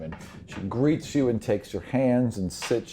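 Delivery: ordinary delivery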